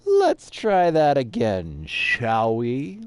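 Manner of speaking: loud, slow pompous announcement; mock grandeur. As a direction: announce it like a hammy hero.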